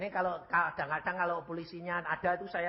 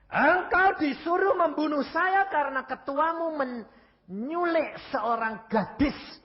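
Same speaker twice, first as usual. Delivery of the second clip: loud, high, drawn-out denunciation; thundering accusation; 12 semitones higher, 8 dB louder, slower than usual. Retold or imitated. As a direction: hammer out the accusation slowly at full voice. Retells accusing the killer's chief. (tuduhan lantang, tinggi dan lambat)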